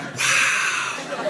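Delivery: a quiet, grudging wow; impressed cop. Retold or imitated. Comic voicing the cop seeing the basement, hushed wow. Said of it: "He was like".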